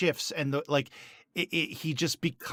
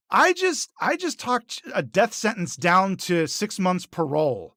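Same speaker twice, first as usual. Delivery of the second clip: loud, indignant self-praise; indignant boast; 3 semitones higher, 5 dB louder than usual. Retold or imitated. Jimmy protests to the angry Fall Guys, indignant boast.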